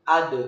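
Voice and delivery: AD with the final d bounced into a tiny vowel; bouncing d. Shown as a demonstration. Teacher shows vowelless da, echoing final d. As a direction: release the D in AD with a little bounce. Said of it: "huruf da terdengar suaranya memantul".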